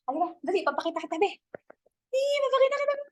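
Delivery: high, fast sing-song coaxing; coaxing call to a cat. Real, not acted. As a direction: call the kitty over sweetly. Calling her cat over.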